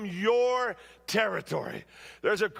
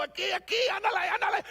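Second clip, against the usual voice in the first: put-on high, urgent calls to the running kid; calling out in Spanish; 11 semitones higher, faster than usual. Coach running down the line, urging the boy on in high excited calls. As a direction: cheer him on, pitched way up. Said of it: "in my only Spanish words I know".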